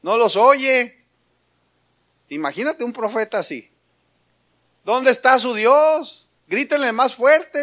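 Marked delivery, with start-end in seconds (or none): 0.0-1.1 loud, slow mocking call to the false prophets; mocking taunt. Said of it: "Elías hasta se burló". 4.8-7.6 higher, slow scornful dare; mocking taunt. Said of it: "Elías hasta se burló".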